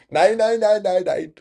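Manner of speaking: high, loud "Nine, nine, nine" in a mock German yell; shouted nines. Shown as a demonstration. German streamer to chat, hamming up the nines in a loud high yell. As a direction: belt out "Nine" like a caricature German. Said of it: "say nine".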